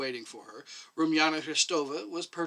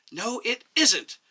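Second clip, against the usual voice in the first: sharp, slow snap, higher and louder; snapped; 9 semitones higher, 7 dB louder, much slower than usual. A narrator's reading. Young doctor snaps at the elderly doctor, sharp higher snap. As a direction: bite back the words. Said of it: "the young doctor snapped".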